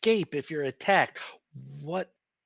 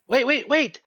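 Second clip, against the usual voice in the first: urgent; 7 semitones higher, 3 dB louder than usual. Real, not acted. Stopping party member from biting.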